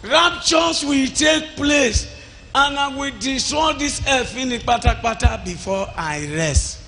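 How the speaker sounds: high, lifted preaching voice; voice of God. Retold or imitated. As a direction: speak God's words up high. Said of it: "He said".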